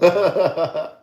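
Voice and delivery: water squeaked out high through a laugh; laughing while saying water; water said high and loud, laughing. Real, not acted. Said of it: "Hold on, let me say it".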